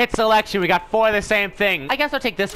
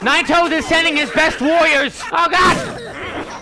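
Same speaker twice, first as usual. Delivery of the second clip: raised-voice mock-epic warning; alarmed battle shout; 8 semitones higher, slower than usual. Real, not acted. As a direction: cry out as the attack lands. Alarm as enemies attack.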